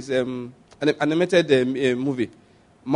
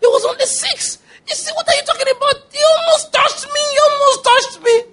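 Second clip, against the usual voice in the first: mock exasperated shout, far higher; hammed-up squeaky exasperation; little monster scolding. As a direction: yell at the scaredy monster, fast and shrill. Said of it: "The little one said".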